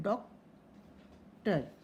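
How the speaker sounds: slow two-part sounding out of "डॉक्टर"; syllable by syllable. Shown as a demonstration. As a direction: leave a long gap inside "डॉक्टर". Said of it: "जितने syllable है".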